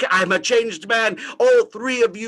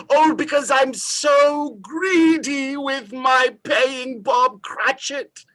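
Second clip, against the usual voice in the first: ordinary talk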